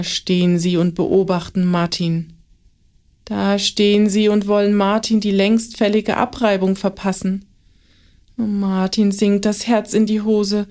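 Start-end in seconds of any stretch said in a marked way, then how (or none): none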